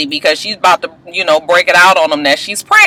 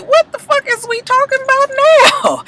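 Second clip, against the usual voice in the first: much higher, put-on voice of total disbelief; incredulous outburst. Vlogger reacting to Shay Mack's story, mock baffled. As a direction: squeak it out, baffled by the tangent. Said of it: "And I was just like".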